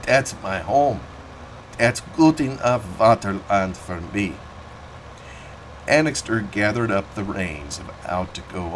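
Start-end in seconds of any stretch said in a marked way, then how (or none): 0.0-4.4 put-on thick German-accented old farmer, reminiscing; a proud old German immigrant telling his war story. Old German farmer telling Annixter about Gravelotte, in a thick German accent.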